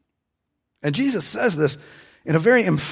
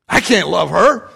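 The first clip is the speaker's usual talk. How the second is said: louder exasperated refusal; protesting husband; 4 semitones higher, 8 dB louder than usual. Retold or imitated. Husband refusing the counselor, louder exasperated protest. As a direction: push back, fed up.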